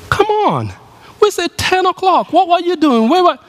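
high, exasperated scolding; angry parent. Retold or imitated. Angry parent scolding late teen.

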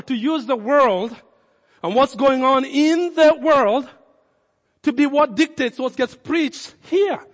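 loud, rising pastor's challenge; passionate retold question. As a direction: hammer the questions at fellow pastors. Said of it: "one of the things I said".

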